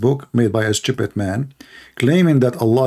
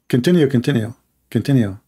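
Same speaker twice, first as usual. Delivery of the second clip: usual speaking voice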